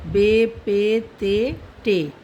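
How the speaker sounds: बे पे ते टे said slowly, letter by letter; slow alphabet chant for students; slow recitation. Shown as a demonstration. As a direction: recite बे पे ते टे like a drill. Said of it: "reciting the alphabet letters".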